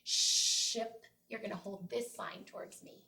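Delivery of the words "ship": "ship" with a long hissed sh; teacher demo with the sh held; clear sh word. Shown as a demonstration. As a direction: stretch the sh in "ship". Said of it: "has an S H sound in".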